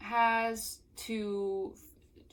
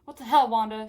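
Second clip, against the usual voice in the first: louder, higher outraged question; mild screech; 4 semitones higher, 11 dB louder than usual. Acted. Melanie berating Wanda, mildly screeched outrage. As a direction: screech it, but held back. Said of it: "she screeched at me".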